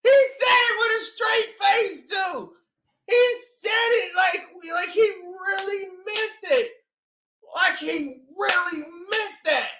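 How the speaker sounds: can't believe what he just heard; incredulous. Real, not acted. Reacting to the commissioner's straight face, high and incredulous. Disbelief at the straight-faced statement.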